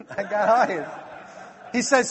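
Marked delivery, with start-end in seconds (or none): 0.1-0.9 saying it again through a real laugh. Real, not acted. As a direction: chuckle the line at his own luck.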